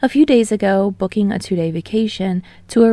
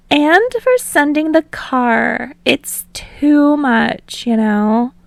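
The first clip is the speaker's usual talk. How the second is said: bubbly guest; 7 semitones higher, 3 dB louder, much slower than usual. Acted. Ashido thanks hostess.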